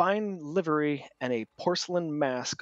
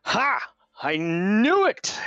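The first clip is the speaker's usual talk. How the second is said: loud, high, slow gleeful cry; booming delighted knight; triumphant boast; 7 semitones higher, 5 dB louder, slower than usual. Acted. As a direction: crow it like a proud hero. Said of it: "he goes".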